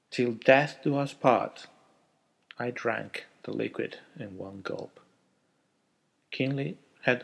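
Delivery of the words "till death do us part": solemn vow. A narrator's reading. Wedding vow.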